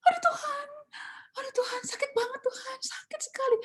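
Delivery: high, crying whispered plea; tearful prayer. Retold or imitated. Hurt choir teacher praying to God after being yelled at: crying whisper. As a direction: cry out to God through tears. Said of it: "saya sedih banget".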